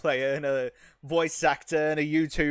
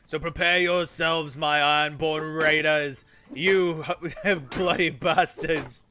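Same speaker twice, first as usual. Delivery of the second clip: loud, roaring raider captain; raider war speech; 4 semitones higher, 9 dB louder, slower than usual. Acted. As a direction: bellow at your Ironborn crew. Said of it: "ability to roleplay".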